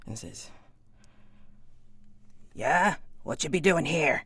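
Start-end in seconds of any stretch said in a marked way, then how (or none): 2.5-4.2 loud, high rough-spoken hired man; gruff wagon hand. Acted. Wagon hand challenging the marshal, loud and gruff. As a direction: bark it at the strangers like a suspicious hired hand.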